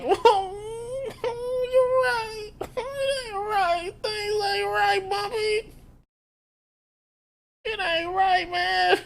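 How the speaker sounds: very high, slow, whimpering fake cry; mock crying Chin. Chin agreeing tearfully with Bumpy, very high mock sob. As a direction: blubber it like a wounded puppy.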